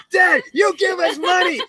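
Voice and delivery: high, loud mock kid shout; hammed-up kids yelling for money. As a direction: play a pushy little kid. Imitating kids demanding child support.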